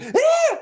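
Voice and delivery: very high imitated shriek of a scared girlfriend; girlfriend's spider scream, imitated high; mock shriek. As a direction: shriek way up high, mocking her panic. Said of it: "she screamed like I'd never heard".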